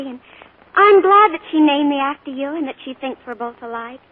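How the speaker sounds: normal talking voice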